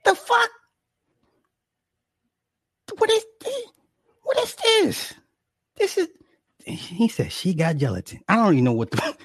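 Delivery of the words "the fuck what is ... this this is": much higher, squeaky shock; can't believe it exists; shocked disbelief. Real, not acted. Streamer baffled by snack packs, high shocked.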